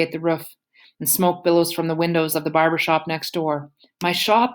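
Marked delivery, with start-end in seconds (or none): 4.0-4.5 distraught shopkeeper crying out; anguished cry. A narrator's reading. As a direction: cry out as the shop burns. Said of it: "Mister Lewis cries".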